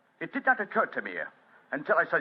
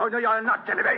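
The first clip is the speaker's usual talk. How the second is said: defiant outburst; 9 semitones higher, 4 dB louder than usual. Acted. Draws revolver against arrest.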